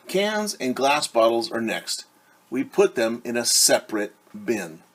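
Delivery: usual speaking voice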